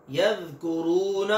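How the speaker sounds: यज़कुरून with a thin, soft dhal and no whistle, drawn out; Arabic dhal, thin and soft. Shown as a demonstration. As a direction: say यज़कुरून with a light unwhistled dhal. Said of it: "इसको मोटा नहीं करना".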